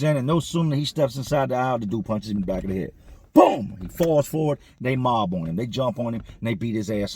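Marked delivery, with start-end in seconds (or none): 3.3-3.8 sharp, much higher, loud "Boom!"; punch sound. Retold or imitated. Narrator acting out the punch to the head, loud impact sound.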